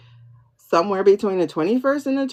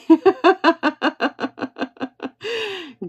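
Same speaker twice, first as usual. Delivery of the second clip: high, delighted laugh trailing off; long high laugh; 10 semitones higher than usual. Real, not acted. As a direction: laugh until it runs out. Laughing after teasing.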